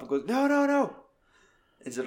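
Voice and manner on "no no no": high squeaky mock protest; mock squeal. Retelling the wounded actress's protest, a high mock squeak. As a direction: squeak it out in panic.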